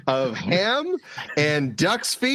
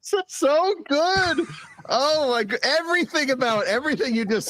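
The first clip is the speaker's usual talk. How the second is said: delighted; 11 semitones higher than usual. Real, not acted. Delighted reaction to player's idea.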